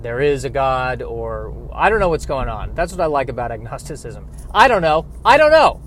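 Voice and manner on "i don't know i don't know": loud, high cry of not knowing; emphatic shout. Acted. Agnostic proclaiming his whole creed, emphatic shout. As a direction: belt it out as a creed, peaking in the middle.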